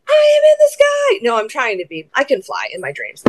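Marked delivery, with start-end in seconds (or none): 0.1-1.2 very high, playful flying cry; mock superhero. Stepmom playing Captain Keeley taking flight, mock superhero. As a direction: soar up into a hammed-up superhero call.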